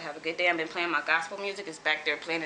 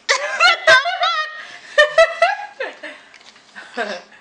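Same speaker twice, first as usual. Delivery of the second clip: big high burst of laughter; loud laugh; 12 dB louder than usual. Real, not acted. Laughing at rival.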